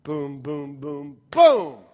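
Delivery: voiced drum hits, the final boom loud; drumbeat imitation. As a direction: beat out the accent like a drummer. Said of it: "That's an accent".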